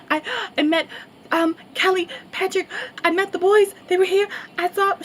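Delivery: high, halting starstruck stammer; flustered stammer. Retold or imitated. Telling her friend she met the boys, stammering. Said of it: "I'm stammering like an idiot".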